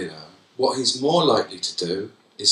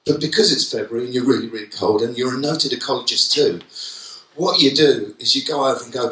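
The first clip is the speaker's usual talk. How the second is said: ordinary talk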